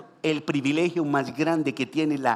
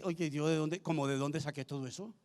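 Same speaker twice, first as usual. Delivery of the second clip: low, soft musing to oneself; puzzled aside; 4 semitones lower, 10 dB quieter than usual. Acted. Believer wondering where those words came from, hushed puzzlement. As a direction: drop right down, as if privately baffled. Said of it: "uno al final dice".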